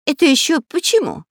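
high, startled frightened question. A narrator's reading. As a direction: ask it in sudden fright. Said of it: "испуганно спросил разбойник Дырка".

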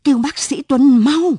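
wife screaming for help; frantic shout. Acted. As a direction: scream it in panic. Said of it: "tiếng bà thét giật".

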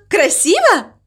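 high, eager, hopeful question; delighted question. A narrator's reading. Mama Mu asks crow for praise, high and delighted. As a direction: ask it high, hoping for praise. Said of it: "Она была в полном восторге".

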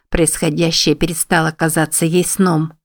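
usual speaking voice

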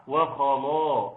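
وخلا with the kha dragging the vowels dark; wrong heavy kha. Shown as a demonstration. Teacher demonstrates the mistake to avoid, heavy kha. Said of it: "ما ينفعش".

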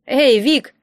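loud, haughty hail; arrogant call. A narrator's reading. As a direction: call out with a sneer. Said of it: "знакомый надменный голос".

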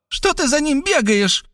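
put-on high girlish indignant question; mock the rival, high. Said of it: "возмущённо спросила она". (высокий возмущённый девичий голос)

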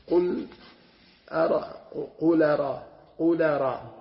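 "قل أرى" said with no hamza, smooth glide into أرى; softened hamza demo. Shown as a demonstration. Teacher demonstrates Nafi's reading without hamza, softened hamza. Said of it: "ما في همزة، يخفف".

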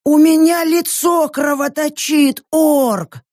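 plaintive whining complaint; whiny tattling little sibling. Acted. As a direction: whine it like a hurt kid. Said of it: "жалобно заныла тётка с ушками".

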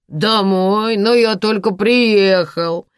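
put-on loud drunk protest; shouting. A narrator's reading. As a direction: drunk man shouts, indignant. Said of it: "закричал Саша".

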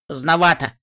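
loud, curt snap; snapping. Acted. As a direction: snap back sharply. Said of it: "огрызнулась я".

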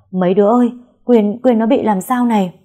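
loud, high, frantic call for help; panicked calling out. A narrator's reading. Mother finds her daughter collapsed, calls out in panic. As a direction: shout upstairs in alarm for the girls. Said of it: "hốt hoảng chạy lại rồi hô hào".

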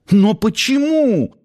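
high, drawn-out cry of why; acted youthful outcry; exclaimed protest. A narrator's reading. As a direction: cry out in high-pitched disbelief. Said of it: "воскликнул Нанос".

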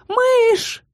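high, drawn-out cry; yell. A narrator's reading. Heroine crying out for her fallen mouse, high yell. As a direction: cry out high without added volume. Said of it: "заорала я".

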